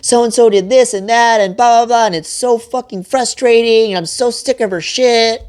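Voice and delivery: high, whiny ranting wife impression; mocking frustrated wife. Husband imitates wife venting about a friend, high and whiny. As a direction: rant in a high mock-wife voice. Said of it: "she's gonna be like".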